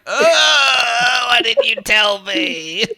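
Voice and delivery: high, drawn-out whining cry; hammed-up slow, tearful howl; mock wail. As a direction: wail it like a wronged kid. Acted child wailing at mom.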